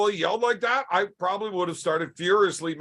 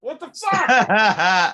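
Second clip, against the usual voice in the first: 1 laughing exclamation; 5 dB louder, much slower than usual. Real, not acted.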